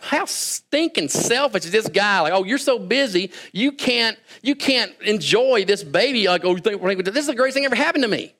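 high-pitched, incredulous mock outrage; mock indignation. Smug new dad judging his struggling friend, mock indignant. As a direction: scold him in exaggerated, rising disbelief.

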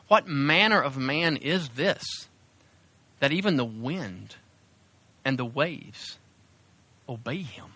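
slow, hushed awe with long pauses; marveling fear. A narrator's reading. Terrified disciples marveling among themselves, hushed awe. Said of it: "they were terrified and marveled among themselves".

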